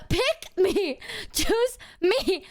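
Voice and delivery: high, pleading Meredith impression; imitating Meredith. Vlogger quotes her favourite scene, mock pleading. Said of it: "that one scene where she's like".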